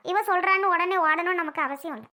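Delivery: normal talking voice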